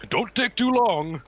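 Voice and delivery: slow, high, loud booming send-off; hammed-up monster merchant; Minotaur calling after customer. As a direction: holler it after them with showman's swagger. Minotaur calls after departing customer.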